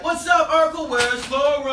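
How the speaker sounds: high, loud heckling shout; heckler. Retold or imitated. Passers-by taunting the comic as Urkel, loud heckle. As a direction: heckle him from across the street. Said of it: "they would heckle me".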